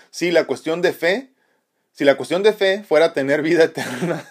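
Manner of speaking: reading a comment through real laughter; can't keep from laughing at the words; half-giggling read-out; laughing while reading. Real, not acted. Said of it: "me río porque por lo que dice Laurita".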